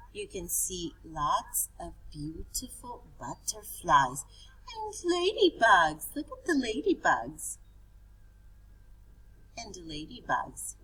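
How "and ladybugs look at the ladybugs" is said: high, loud delighted cry; excited. Acted. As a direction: burst out with delight for the kids. Excited teacher showing picture.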